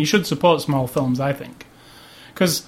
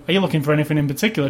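rattled off quickly; quick pushy salesperson; fast clerk voice; much faster than usual. Retold or imitated. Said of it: "And then they go".

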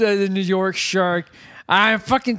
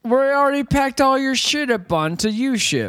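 mock character voice; 6 semitones higher than usual. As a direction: tease the family as a pushy mover. Voicing characters in the film.

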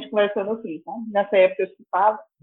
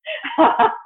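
real amused laugh; burst of laughter; 13 semitones higher than usual. Real, not acted. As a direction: laugh out at the absurdity. Laughing at own story.

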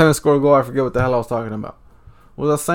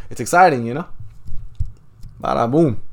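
ordinary talk

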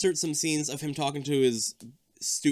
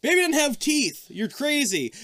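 mocking parents; 11 semitones higher, 3 dB louder than usual. Parents dismiss son.

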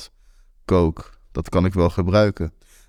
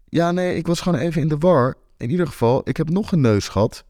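quick, higher, reassuring cover-up; 8 semitones higher, faster than usual. Acted. Sebas quickly reassures Aniek not to disappoint her, higher and fast. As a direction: brush it off fast to cheer her. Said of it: "om haar niet teleur te stellen".

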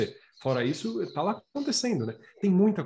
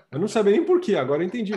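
laughing; 5 semitones higher, 5 dB louder, faster than usual. Real, not acted. Joking about the ventilador post.

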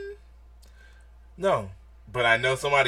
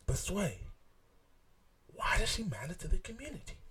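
whispered quote; 10 semitones higher, 7 dB quieter than usual. Retold or imitated. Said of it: "That was a reference to".

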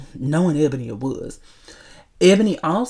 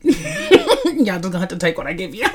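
higher, laughing brush-off; 12 semitones higher than usual. Real, not acted. Host telling listeners she won't fix mistakes, laughing. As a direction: say it while really cracking up.

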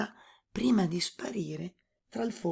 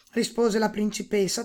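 usual speaking voice

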